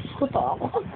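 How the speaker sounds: hushed mock-frightened voice; pretend fear. Parent acting out takot for child, pretend frightened. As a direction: act scared, very softly. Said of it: "Takot, takot".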